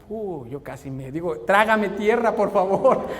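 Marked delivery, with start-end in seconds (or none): 1.4-3.1 loud mortified exclamation; much louder, hammed-up shame; mortified outcry. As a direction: cry it out in embarrassed despair. Father ashamed, wishes to vanish.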